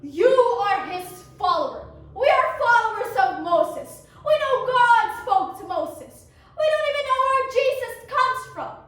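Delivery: loud, hammy scornful rant; insulting. Acted. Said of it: "The leaders hurled insults at me".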